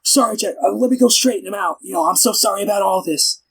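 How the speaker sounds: high-pitched, flustered apologizer; hurried, high mock apology; imitating apologetic Reed. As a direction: put on a high voice and apologize profusely. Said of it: "Reed follows him. He's like".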